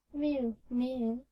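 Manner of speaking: high, loud mew like a hungry cat; a loud, high cat's mew. A narrator's reading. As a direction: cut in with a pleading cat's mew. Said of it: "interrupted Simpkin".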